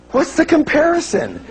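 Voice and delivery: high-pitched, incredulous comeback; mock exasperation. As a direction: scoff at the objection in a high voice. Mock rebuttal to imagined objector.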